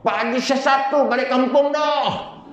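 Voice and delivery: high, drawn-out village holler; high-pitched mock calling out; mock yell. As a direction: imitate an aunt crying out to the whole village. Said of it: "dia teriak".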